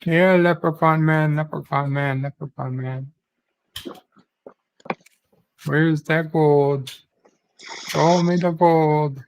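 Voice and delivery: lightly put-on Irish accent, jolly; a hint of a merry Irish leprechaun hunter. Streamer playing Irish Opre for chat, mock Irish accent. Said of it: "Where's Irish Opre?".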